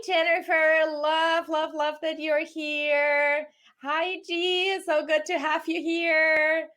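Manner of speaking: normal talking voice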